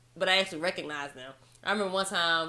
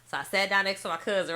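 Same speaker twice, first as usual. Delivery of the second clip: ordinary delivery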